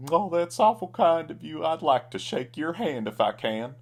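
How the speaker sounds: high, loud clown voice; grateful clown. A narrator's reading. Clown thanks cowboy, high grateful voice. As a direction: gush it in the clown voice.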